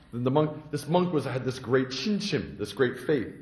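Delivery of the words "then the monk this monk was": usual speaking voice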